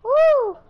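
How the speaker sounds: high, loud "woo"; cheering whoop. Real, not acted. Player cheering a play, excited whoop. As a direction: whoop it up loudly.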